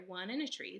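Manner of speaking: ordinary delivery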